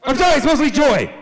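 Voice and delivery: high, loud mock-emotional cry; overwrought outburst. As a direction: burst out as if losing composure. Protesting after admitting deep sadness.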